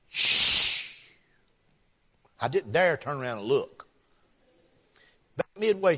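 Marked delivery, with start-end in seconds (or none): 0.1-1.0 long, forceful voiceless hiss; pressure cooker hiss. Retold or imitated. Preacher mimicking the old lady behind him, long hissed shush. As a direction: imitate steam rushing out of a pressure cooker. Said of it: "she'd do it again".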